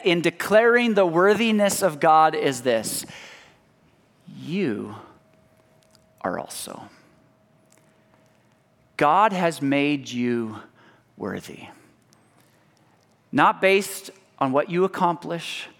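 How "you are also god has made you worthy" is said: tender hush. Acted. Tender address to listeners.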